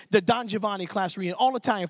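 normal talking voice

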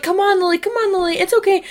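high, sing-out coaxing of a straggler; acted friends calling encouragement; imitated coaxing. Friends coaxing her from ahead.

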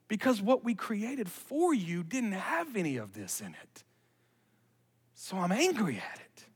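soft, measured reassurance with pauses; hushed earnest preaching. Real, not acted. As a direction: drop your voice and explain it tenderly. Hushed preaching, voicing Jesus' words.

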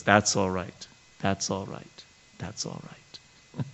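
a lightly voiced fatherly murmur, fading to a soft breath; fatherly reassurance. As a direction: gently reassure a shy disciple, trailing off. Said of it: "replies in such a fatherly, fatherly voice".

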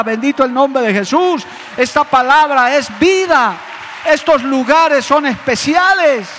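loud, high, fervent sermon; full-force preaching. Acted. Pastor exhorting the congregation, loud and fervent. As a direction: drive every line home to the pews.

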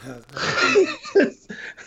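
a sudden, very high burst of laughing; burst of high laughter. Real, not acted. Host bursting into high laughter at his cohost falling for spam. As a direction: crack up in high-pitched disbelief.

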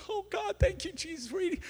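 very high-pitched mock rapture; overjoyed exclamation. Hungry man told dinner will be even better, overjoyed. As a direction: squeal thanks as if a prayer was answered. Thrilled reaction to a promised meal.

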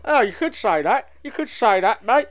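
comic character voice. Shown as a demonstration. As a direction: repeat it like a daft character.

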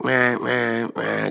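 mimicked horn bleats; imitated car honking. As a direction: honk like an impatient driver with your voice. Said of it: "starts randomly just honking".

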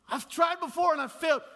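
high, loud put-on protest; mock complaining objector. Preacher voices believer who failed before, mock protest. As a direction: imitate an objector, voice jumping way up.

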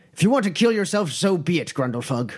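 a dwarf scornfully giving up; bitter dwarf. Acted. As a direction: spit it out, fed up.

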